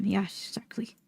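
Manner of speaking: soft unvoiced whisper. Real, not acted. Streamer to chat, a quick whispered aside. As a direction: breathe it out, barely there. Aside while reading the book.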